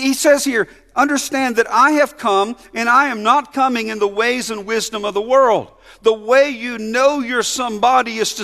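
preaching. Acted. Pastor proclaiming the promise to the church, preaching.

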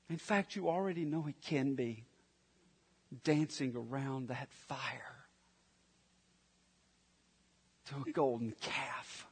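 hushed warning. Real, not acted. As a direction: lean in and warn them softly. Preacher warns, voice sinks low.

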